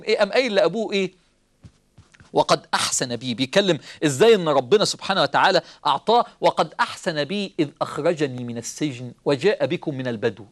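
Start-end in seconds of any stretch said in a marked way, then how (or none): 2.3-3.3 lower, measured recitation of the verse; Quranic recitation. Acted. Preacher quoting Yusuf to his brothers, low recitation. 6.4-10.4 lower, measured recitation of the verse; Quranic recitation. Acted. Preacher quoting Yusuf to his brothers, low recitation.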